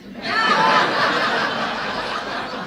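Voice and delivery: a burst of high breathy laughter; mother laughing at her own naivety about her son; high wry laugh. Real, not acted. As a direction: laugh at how blind you were.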